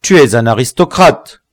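exasperated and accusing; impatient accusation. A narrator's reading. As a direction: hurl the accusation at the prisoner. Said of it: "d'un air impatienté".

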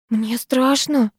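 high, slow and scared; scared girl admitting it; frightened admission. A narrator's reading. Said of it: "призналась Вика".

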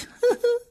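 ricanement aigu cruel; rire mauvais. A narrator's reading. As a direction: ricaner méchamment. Rire du méchant. (rire cruel)

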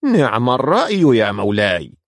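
whinnying, swooping flattery; happy neighing horse. A narrator's reading. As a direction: praise the king in a horse's gleeful neigh. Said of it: "الحصان الذي صهل في سعادة".

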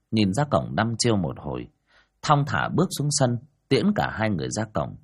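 ordinary delivery, nothing marked

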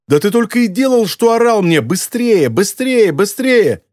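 high-pitched indignant tirade; furious yelling. A narrator's reading. Girl accuses teacher of yelling at her, furious. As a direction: mimic his urging in outraged exasperation. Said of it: "яростно завопила она в ответ".

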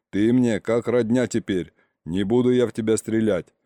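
usual speaking voice

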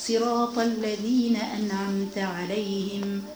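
slow, measured Quranic chanting, drawn out; Quran recitation. Acted. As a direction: recite each verse slowly for the women following silently. Continues recitation of surahs. (تلاوة قرآن بطيئة ومرتلة)

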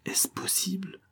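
soft, high, whispery disbelief; troubled. A narrator's reading. Shaken lady alone on the dark stairs, troubled. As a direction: murmur it in shock, softly and high. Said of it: "dire d'un air troublé".

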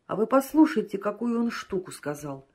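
usual speaking voice

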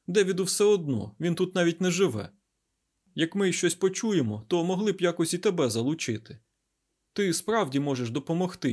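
lifted, insistent offer; Айзек. Acted. Scientist offering the garuda a place to stay, coaxing.

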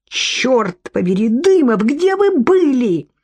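drawn-out, climbing outrage; high-pitched acted wail of anger; angry yell. A narrator's reading. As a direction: climb high as you demand an answer. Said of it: "завопила Софья в гневе".

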